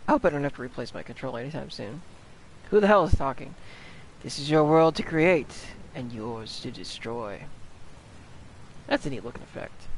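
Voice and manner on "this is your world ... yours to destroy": portentous game voice, fading down; slow reading. Acted. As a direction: draw each word out slowly. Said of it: "I don't read that slowly".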